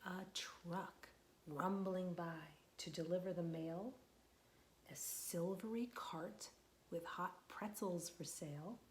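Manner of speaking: slow, gentle picture-book reading; reading to children. Acted. Teacher reading a picture book aloud to children, slow and gentle. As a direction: read it slowly to the little ones.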